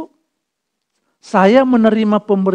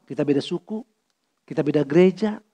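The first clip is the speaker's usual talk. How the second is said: usual speaking voice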